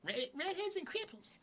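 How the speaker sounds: high, quiet cartoon-kid impression; Eric Cartman. Friend mocking the redhead remark as a cartoon brat, high imitation. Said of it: "a more Eric Cartman-y thing".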